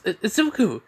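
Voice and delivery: high, stammered cry of surprise; startled stammer. Acted. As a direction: blurt the name in shock. Katsumi sees him awake, startled.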